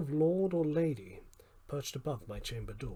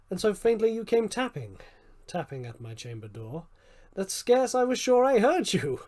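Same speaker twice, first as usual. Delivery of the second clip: louder, higher pleading to the visitor; loud pleading apology; 6 semitones higher, 10 dB louder than usual. A narrator's reading. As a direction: beg forgiveness at full voice. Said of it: "said I".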